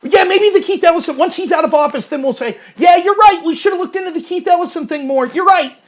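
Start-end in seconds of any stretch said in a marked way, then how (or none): 2.8-5.8 high-pitched, hammed-up conceding; mock agreement. Partisans conceding once he's out of office, mock agreeable. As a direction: imitate a too-easy admission, pitched up. Mimics late, easy agreement.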